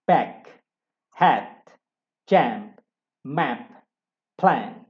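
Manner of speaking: back, head, jump, map, plan said loud as drill words; model words. Shown as a demonstration. Teacher reading out a word list loudly. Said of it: "Coba dilihat di sini".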